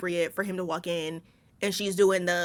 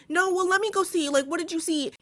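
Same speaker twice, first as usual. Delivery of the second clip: high-pitched mocking imitation of a dope; dumbass guy; 9 semitones higher than usual. Keith brushes off Tess's warning, mock dumbass voice. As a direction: put on a much higher, clueless voice. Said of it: "Keith's dumbass is like".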